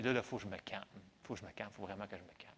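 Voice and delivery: soft, low self-talk, barely holding it together; calming himself down. Retold or imitated. Angry neighbour tells himself to calm down, softly. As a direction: talk yourself down quietly, almost under the breath.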